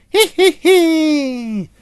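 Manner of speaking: high, slow cheeky monkey giggle. A narrator's reading. Monkey giggles at the deaf lion, high and slow. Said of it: "And the monkey went".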